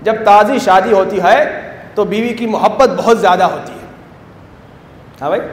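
ordinary delivery

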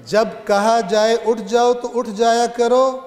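high, loud scripture declamation; preaching recitation. Acted. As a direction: proclaim the command to the whole congregation. Repeats the verse, declaiming.

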